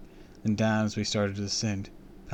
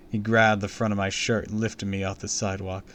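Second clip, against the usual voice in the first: ordinary delivery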